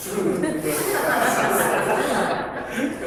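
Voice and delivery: breathy, high amused laugh; a real laugh at the new watch. Real, not acted. Speaker laughing at the watch that tells you to breathe.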